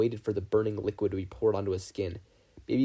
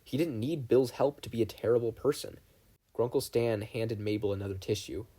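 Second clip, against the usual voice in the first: ordinary delivery, nothing marked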